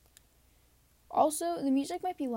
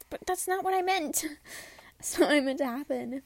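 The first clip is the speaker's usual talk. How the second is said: flustered protest; 4 semitones higher, 7 dB louder than usual. Retold or imitated. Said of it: "And then you're just like".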